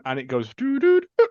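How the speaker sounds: sung doo doo doo beeps; phone disconnected tone. Retold or imitated. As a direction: imitate a dead line tone. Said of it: "like when the phone's disconnected".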